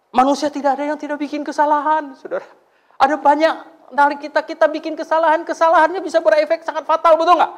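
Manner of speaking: high, loud ringing sermon delivery; full-force preaching. Acted. Preacher warning the congregation about fatal mistakes, at full force. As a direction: drive the warning home to the pews.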